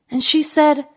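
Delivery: plain reading voice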